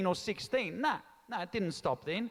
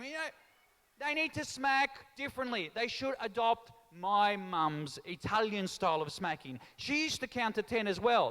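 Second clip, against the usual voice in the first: ordinary delivery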